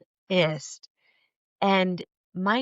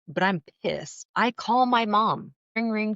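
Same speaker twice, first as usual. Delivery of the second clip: ordinary talk, nothing marked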